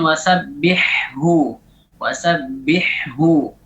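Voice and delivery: wasabbihhu slowly, both ha distinct; slow demo; clear double ha. Shown as a demonstration. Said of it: "Seperti ini harus dijelaskan".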